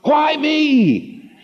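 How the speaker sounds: rising to a high anguished cry. Retold or imitated. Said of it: "crying out and saying".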